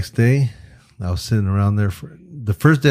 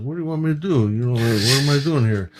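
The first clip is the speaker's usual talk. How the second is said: lightly voiced puzzled actor, raised pitch; Robert De Niro; 5 semitones higher than usual. Actor joining the shoot and asking directions, puzzled impression. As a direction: question it like a confused film star. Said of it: "Robert De Niro is like".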